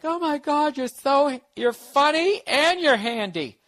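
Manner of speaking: high, gushing mock-girlfriend voice. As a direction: gush with exaggerated, high-pitched admiration. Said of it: "so my girl could be like".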